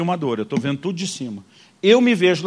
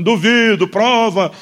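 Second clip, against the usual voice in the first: "Duvido, prova" called out louder and higher; shouted dare; 4 semitones higher, 6 dB louder, much slower than usual. Retold or imitated. Preacher in the vision yelling at the praying man, loud and higher. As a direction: shout the dare at him across the church. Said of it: "O eu da visão gritando pra ele".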